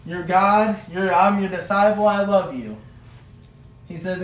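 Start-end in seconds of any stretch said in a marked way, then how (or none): 0.1-2.8 higher and louder, earnest begging; pleading Peter. Acted. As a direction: plead your love with everything. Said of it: "Peter's like".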